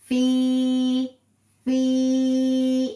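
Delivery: long drawn-out फी held for two counts; फी with the long vowel drawn out; stretched fee. Shown as a demonstration. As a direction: stretch the फी slowly like a tajweed teacher. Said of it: "तो हम इसे पढ़ेंगे".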